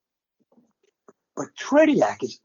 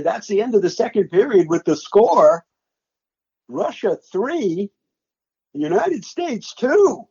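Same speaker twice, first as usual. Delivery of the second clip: slow, rising announcer call climbing higher on the score; replaying his own broadcast call; 6 semitones higher than usual. Broadcaster replaying his live call of the period's final score, slow and rising. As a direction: call the score slowly like a stunned broadcaster, lifting each number. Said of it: "that's exactly how I said it in my play-by-play".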